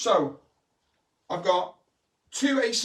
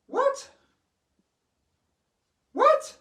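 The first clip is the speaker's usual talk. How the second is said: high squeaky "What?", much higher; mock surprise; 5 dB louder than usual. Pretending to be amazed at the rising band, high squeak. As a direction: act amazed at the trick.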